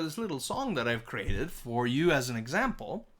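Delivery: ordinary delivery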